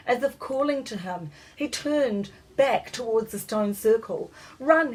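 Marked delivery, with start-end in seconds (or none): 4.6-4.9 high, loud call to run; boy yelling to himself and his dog; yelled. A narrator's reading. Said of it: "he yelled to himself and Cootie".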